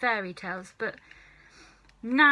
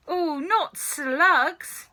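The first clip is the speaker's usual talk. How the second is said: slow, higher wail of disgust; crying out in disgust; 8 semitones higher, 5 dB louder, much slower than usual. Acted. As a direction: recoil from the idea of slugs. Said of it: "George cried out".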